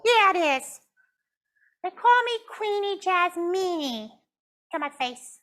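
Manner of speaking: pouting puppet. Acted. Puppet Jasmine tells the counselor she is mad, pouting high. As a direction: stretch out the sulk.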